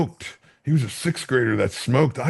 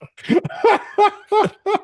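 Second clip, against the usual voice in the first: high, loud burst of laughter; real helpless high-pitched laugh; 4 dB louder than usual. Real, not acted. Cracking up at his own childhood story, laughing.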